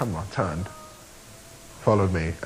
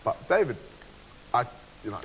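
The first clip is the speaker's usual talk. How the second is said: ordinary talk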